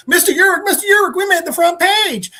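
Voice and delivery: high, loud mock-boyish excitement; excited news-bearer. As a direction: imitate an eager kid calling out big news. Excited Peter runs back with news.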